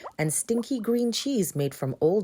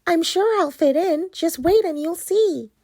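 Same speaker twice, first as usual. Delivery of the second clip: cheerful pumpkin voice; 12 semitones higher, 7 dB louder than usual. Acted. Pumpkin reassures witch.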